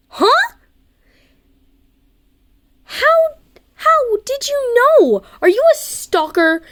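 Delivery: very high, loud shocked squawk; startled. Acted. Startled girl accusing a boy of stalking, very high. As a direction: yelp it in alarm.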